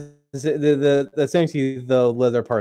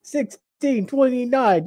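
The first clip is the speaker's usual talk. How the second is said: high, slow and excited; 11 semitones higher, slower than usual. Acted. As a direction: repeat the number with delight. Repeats it excitedly.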